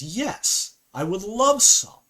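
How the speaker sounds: loud, high-pitched mock exasperation; imitating an indignant complainer, rising in volume; exasperated retort. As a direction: act out an over-emphatic, fed-up yes. Acted exasperated reply to bread offer.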